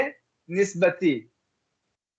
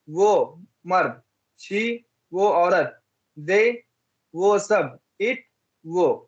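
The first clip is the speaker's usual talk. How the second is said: very slow, word by word, for pupils to repeat; लिखवाने वाले अंदाज़ में धीरे-धीरे; slow drill reading; much slower than usual. Shown as a demonstration. (बच्चों को दोहराने के लिए धीमे-धीमे बोलना)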